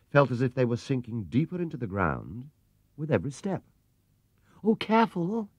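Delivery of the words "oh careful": high alarmed cry; mother crying out a warning to Paddington. A narrator's reading. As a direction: call out to stop him. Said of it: "cried Missus Brown".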